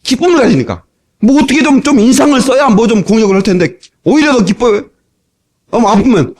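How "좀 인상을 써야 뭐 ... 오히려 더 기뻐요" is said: ordinary delivery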